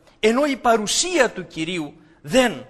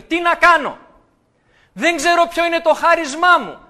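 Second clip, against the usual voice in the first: higher and louder, anxious asking; perplexed believer; 6 semitones higher, 6 dB louder than usual. Retold or imitated. A believer asking the preacher what to do, anxious. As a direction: plead for direction.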